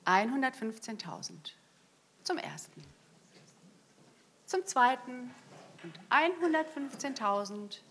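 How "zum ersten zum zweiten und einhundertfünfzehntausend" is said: auctioneer. Real, not acted. Going once, going twice.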